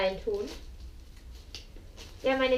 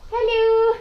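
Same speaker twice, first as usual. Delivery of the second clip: excited greeting; 10 semitones higher, 12 dB louder than usual. Real, not acted. Greeting what's in the vacuum bag.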